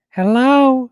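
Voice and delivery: high, loud hello; playful greeting. Pretend. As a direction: call it out to the viewers. Playful exaggerated greeting to viewers.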